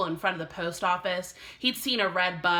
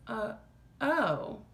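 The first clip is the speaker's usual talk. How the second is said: breathy; 3 semitones higher, 3 dB quieter than usual. A narrator's reading. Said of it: "breathed Janie".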